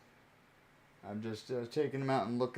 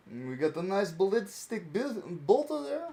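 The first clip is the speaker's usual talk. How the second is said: mock Russian accent; 6 semitones higher than usual. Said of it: "I'll stop the accent there".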